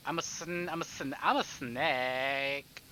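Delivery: ordinary talk, nothing marked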